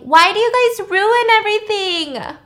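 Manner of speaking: high, loud, petulant whine; whiny yell. Retold or imitated. The fedora guy whining loudly while running away. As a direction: wail it like a sore loser. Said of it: "runs away with his fedora saying".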